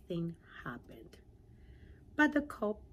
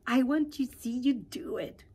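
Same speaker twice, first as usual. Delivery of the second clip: higher and louder, eager; excited pollywog; 6 semitones higher, 7 dB louder than usual. Acted. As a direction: beg to watch, full of excitement. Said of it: "the pollywog said excitedly".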